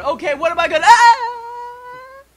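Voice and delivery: rising to a high loud mock yelp; panic, then cut off by a shriek; panicky astronaut. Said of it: "It's like".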